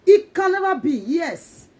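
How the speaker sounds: high loud defiance; defiant cry. Real, not acted. Telling doubters it can never be, high and loud. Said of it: "defiant declaration".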